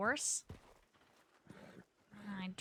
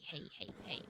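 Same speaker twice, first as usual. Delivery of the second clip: hushing; 4 semitones lower, 8 dB quieter than usual. Real, not acted. Hushing the horse.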